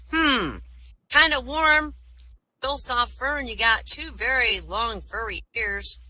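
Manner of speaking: high-pitched put-on snake voice. Blind snake feeling over the rabbit, high snake voice. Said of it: "The snake says".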